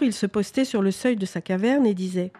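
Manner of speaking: ordinary delivery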